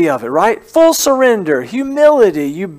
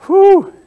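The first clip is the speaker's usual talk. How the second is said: high whew; 14 semitones higher, 6 dB louder than usual. Real, not acted. Exclaims after "impatient.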